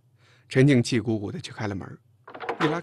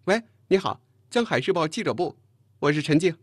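higher, louder telephone hello; phone greeting; 6 semitones higher, 6 dB louder than usual. A narrator's reading. Chen Jing answering the newsroom phone, businesslike and louder. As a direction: announce office and name crisply to a caller.

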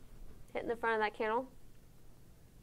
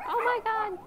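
shocked; 12 semitones higher, 4 dB louder than usual. Real, not acted. Reacting to dog's sore nose.

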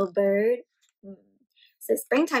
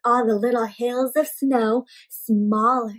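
storybook reading; 5 dB louder than usual. A narrator's reading. Teacher reads book aloud.

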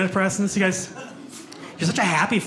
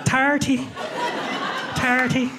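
higher-pitched put-on Irish accent; 6 semitones higher, much slower than usual. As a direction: repeat it in a comic Irish lilt. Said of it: "my Irish accent".

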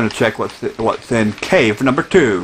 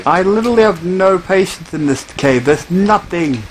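fed-up, higher-pitched complaint; real exasperation, pitched up; exasperated; 6 semitones higher than usual. Real, not acted. Fed up with the empty cave.

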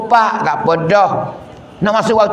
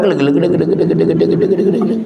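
rapid rolling "gele-gele" noise; even, repetitive machine chatter; imitated fan rattle; much faster than usual. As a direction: mimic a loose fan rattling round and round.

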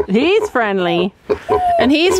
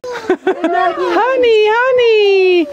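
calling out; 10 semitones higher, much slower than usual. Real, not acted. Calling the horse again.

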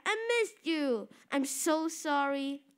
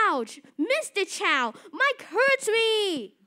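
hurt cry; 5 semitones higher, 7 dB louder, slower than usual. Acted. Punched boy cries to teacher.

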